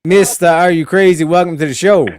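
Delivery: loud, high TV host welcome; talk show host. Pretend. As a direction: welcome the guest like a showman.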